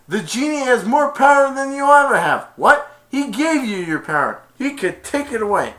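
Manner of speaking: high, loud taunt; provoking taunt. Retold or imitated. Aladdin goading Jafar about the genie, high loud taunt. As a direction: needle the villain's pride.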